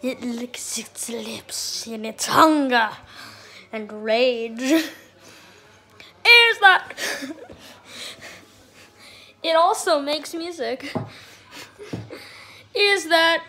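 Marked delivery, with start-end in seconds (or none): 0.0-4.6 slow whispered wildlife narration; nature documentary. Pretend. 12.7-13.4 dog voice. Pretend. Voicing dog at treats.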